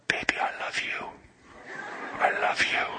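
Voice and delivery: whispering sweet nothings, imitating the roommate; breathy mock whisper of a smooth talker; imitated whisper. Roommate whispering to a girl on the phone, imitated whisper. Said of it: "I'd hear him one night going".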